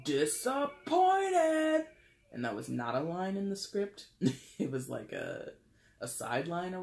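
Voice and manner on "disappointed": drawn-out screamed Disappointed, much higher and louder; mock Kevin Sorbo scream, stretched out; imitated scream. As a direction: belt out "Disappointed!" slowly like a meme. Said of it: "a meme of him screaming".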